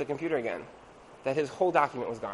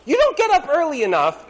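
nagging spouse; 10 semitones higher, 9 dB louder than usual. Retold or imitated. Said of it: "you're like".

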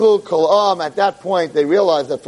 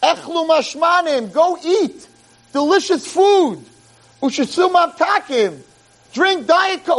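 high, slow proclaiming call to go home and feast; prophet's command; 9 semitones higher, slower than usual. Retold or imitated. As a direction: announce it like a joyful prophet. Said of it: "and he says".

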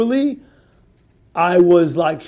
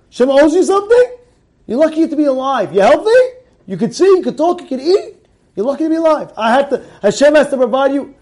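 indignant rebuke; 8 semitones higher, 6 dB louder than usual. Acted. Rebuking the woman's complaint.

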